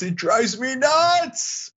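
high, drawn-out exasperation; worked-up, slow and high; exasperated. Real, not acted. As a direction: stretch it out in high-pitched frustration. Venting about lack of nuance.

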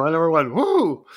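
mock whoop. Echoing Bueno's whoop.

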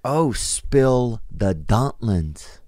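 very slow, word by word; dawning realization. Retold or imitated. Comic finally working out what the lady meant, slow dawning realization. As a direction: draw out each word as the phrase finally makes sense. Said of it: "And then I'm like".

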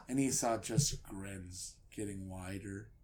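normal talking voice

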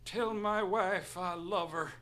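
slow, hammed-up dying gasp; dying last words. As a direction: breathe out your final words. Pretend dying sheriff's last words.

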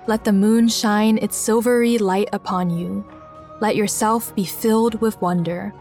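ordinary delivery, nothing marked

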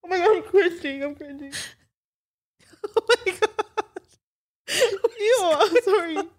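cringing so hard she half cries; laugh-crying. Real, not acted. Cringing at herself on episode.

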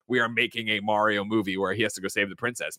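low, mock-serious announcement; straight-up earnest pitch. Imagined filmmakers pitching the movie straight up, mock-earnest and low. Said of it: "not gonna be a straight up".

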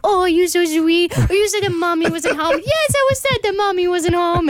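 put-on high baby talk to the cat; pet voice. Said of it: "I do this at home with my cats too".

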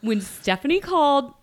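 coughing on a swallowed drink; choking on a sip. Real, not acted. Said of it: "I'm choking on my drink".

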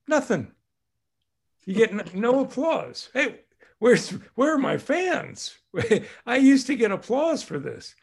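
high, mock-outraged little kid; indignant toddler. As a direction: play a kid missing the applause.

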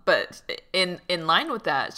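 usual speaking voice

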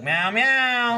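high, twangy meow; twanged meow. Retold or imitated. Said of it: "he twangs it".

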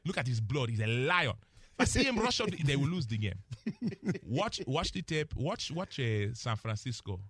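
put-on Nigerian accent. Analyst closing his advice to the Cowboys, in Nigerian accent. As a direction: drive it home in a Nigerian accent. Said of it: "the accent".

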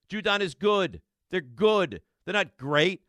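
ordinary talk

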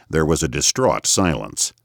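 ordinary delivery

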